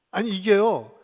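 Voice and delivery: normal talking voice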